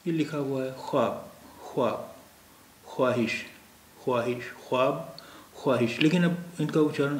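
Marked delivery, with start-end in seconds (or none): 0.7-1.4 ख्वाब read as "khwaab", with the w sounded; spelling pronunciation. Shown as a demonstration. Teacher reading what is written, ख्वाब with an audible w after kh. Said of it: "वाव का उच्चारण ये नहीं होता है". 1.7-6.0 ख्वाब and ख्वाहिश with the w sounded, "khwaab", "khwaahish"; spelling pronunciation. Shown as a demonstration. Teacher reading out the written words, ख्वाब and ख्वाहिश with a clear w. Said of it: "वाव का उच्चारण ये नहीं होता है".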